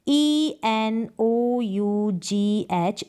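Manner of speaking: each letter spoken slowly and separately; slow spelling out. Shown as a demonstration. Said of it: "जिसको इनफ या एनफ करके आप pronounce". (धीरे-धीरे अक्षर बोलकर)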